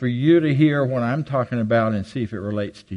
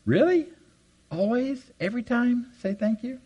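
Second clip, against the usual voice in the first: high, rising disbelief, softening away; incredulous questioning; 11 semitones higher, 4 dB quieter than usual. Pretend. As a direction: question each rule in growing doubt.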